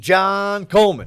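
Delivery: loud drawn-out name call; grand entrance announcement. Acted. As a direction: boom out the name like a ring announcer. Introducing co-host by name.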